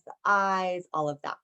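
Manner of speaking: ordinary delivery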